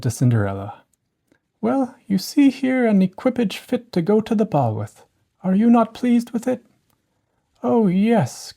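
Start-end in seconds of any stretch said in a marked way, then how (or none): 1.6-6.7 higher, gentle fairy voice; a proud fairy showing off her work; fairy godmother. A narrator's reading. Fairy shows Cinderella the coach, higher fairy voice. 7.6-8.5 put-on higher, eager girl's voice; a touch of delight; mild cry of joy. A narrator's reading. Cinderella answers the fairy, mildly excited higher voice. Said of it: "cried she".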